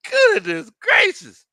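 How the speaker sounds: high, drawn-out laughing exclamation; tickled and scandalized, voice way up; amused disbelief. Real, not acted. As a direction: squeal it slowly and loudly through laughter. Laughing at a viewer's blunt question.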